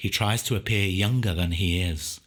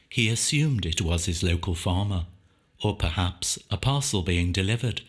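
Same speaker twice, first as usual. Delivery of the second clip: usual speaking voice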